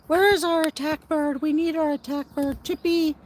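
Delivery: high, loud sing-song call to the birds. Real, not acted. Calling the birds to table.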